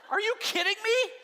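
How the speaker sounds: real indignation at the critics; exasperated disbelief. Real, not acted. Teacher reacts to critics calling Naomi bitter, exasperated.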